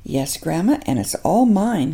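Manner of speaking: ordinary delivery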